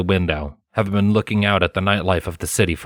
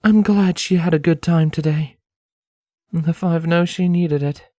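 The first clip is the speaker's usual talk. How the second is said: raised light voice for Scarlet, fondly; Scarlet, gentle young mare; 10 semitones higher than usual. A narrator's reading.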